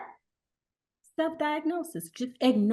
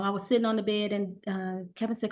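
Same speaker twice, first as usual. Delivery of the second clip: normal talking voice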